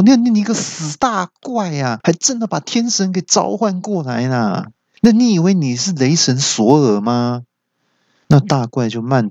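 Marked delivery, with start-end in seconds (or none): 0.0-7.4 高声结巴的惊吓责骂; 被雷吓到后结结巴巴地骂; 惊魂未定地骂. A narrator's reading. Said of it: "惊魂未定地骂说". (惊魂未定地高声骂人)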